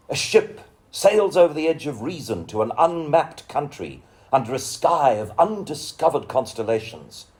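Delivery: measured, lyrical recital of a poem; poetry reading. Acted. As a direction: give each image room, read it like a poet on stage. Reads a poem aloud.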